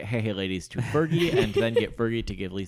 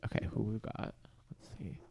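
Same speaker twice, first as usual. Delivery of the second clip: whisper; 13 dB quieter than usual. Real, not acted. Peeking at photos.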